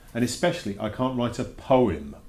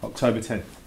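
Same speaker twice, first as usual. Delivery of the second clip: usual speaking voice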